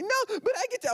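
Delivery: put-on high whiny child protest; whiny kid. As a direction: whine like a kid who wants to stay up. Nephew whining in story.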